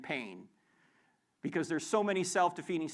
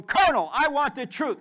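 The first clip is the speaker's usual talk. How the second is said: courtroom demand; 7 semitones higher, 9 dB louder than usual. Retold or imitated. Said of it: "and he said to him".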